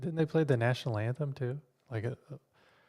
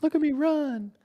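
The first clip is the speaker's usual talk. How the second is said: cat voice; 3 dB louder than usual. Pretend. Voicing the cat.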